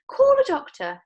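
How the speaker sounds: very high, loud shout. Acted. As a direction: shout for help. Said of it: "shouted Missus Beauregard".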